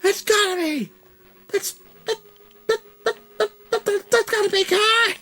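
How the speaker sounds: very high, stammering hype call that keeps restarting; squeaky, stuttering ringside-commentator screech; wrestling announcer call. Retold or imitated. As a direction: sputter it like a commentator losing it. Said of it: "something from wrestling".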